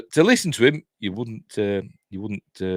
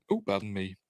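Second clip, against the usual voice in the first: ordinary talk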